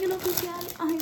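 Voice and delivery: high, loud disbelieving echo; can't believe it's him; shocked question. Real, not acted. Shocked at who the brother is.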